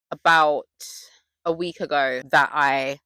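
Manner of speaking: normal talking voice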